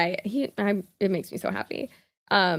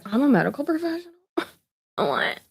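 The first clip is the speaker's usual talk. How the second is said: fast, higher, disbelieving question; mock confused question; 7 semitones higher than usual. Vlogger mocking a misspelled title, fast and incredulous. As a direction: toss it off like it's absurd. Mocking being called medical professional.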